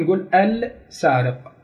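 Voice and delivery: السارق said slowly with the lam clearly pronounced; lam sounded out. Shown as a demonstration. Teacher showing students how the lam is heard. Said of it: "هيك نظهر اللام".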